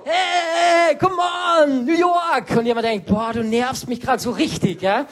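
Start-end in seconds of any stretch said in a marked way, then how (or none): 0.0-2.5 high, loud cheering call; mock hype shout. Imitating himself hyping his grumpy friend back. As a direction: pump up the crowd, half joking. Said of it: "Dann war ich so".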